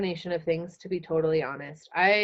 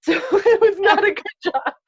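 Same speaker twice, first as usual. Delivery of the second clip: high, loud words through real laughter; laughing; 13 semitones higher, 8 dB louder, slower than usual. Real, not acted. Guest laughing about being bad at selling cars, laughing. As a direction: laugh it out at herself.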